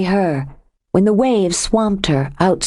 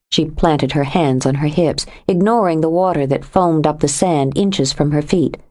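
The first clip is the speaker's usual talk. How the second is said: ordinary delivery, nothing marked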